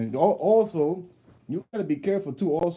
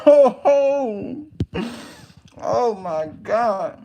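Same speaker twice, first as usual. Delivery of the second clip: loud, high, laughing "oh" slowing down; retold shocked reaction; 8 semitones higher, 5 dB louder, much slower than usual. Man reacting to kid smacking grandmother, amused shock. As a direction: relive the shock, half laughing, drawn out.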